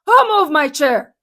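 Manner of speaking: loud, high snapping retort; snappy outburst. A narrator's reading. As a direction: bite their head off. Snappy grape.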